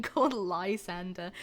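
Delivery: usual speaking voice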